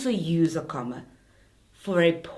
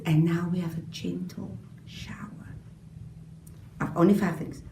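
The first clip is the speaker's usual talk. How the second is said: gentle whisper; 3 semitones lower, 6 dB quieter than usual. Shown as a demonstration. Playful teacher's word picture.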